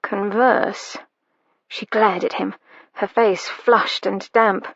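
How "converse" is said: Harry teasing his lady about her word choice; quizzical echo. A narrator's reading. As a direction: repeat converse with a raised eyebrow. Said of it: "Harry cocked an eyebrow".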